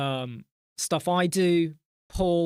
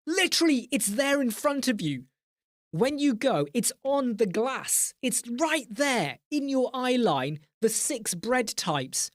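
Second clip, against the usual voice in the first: exasperated; 6 semitones higher than usual. Real, not acted. Streamer mocking customers who can't see the bread types, exasperated. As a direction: point out the obvious in high, stretched disbelief.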